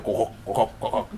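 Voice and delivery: imitated heel clicks in quick pairs; clicking heel noise. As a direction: clack like shoes walking. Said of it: "it makes a noise".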